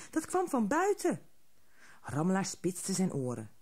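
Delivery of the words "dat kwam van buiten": trembling. A narrator's reading. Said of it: "trilde Haasje".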